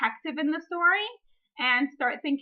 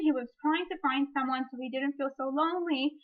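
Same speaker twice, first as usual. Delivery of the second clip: ordinary talk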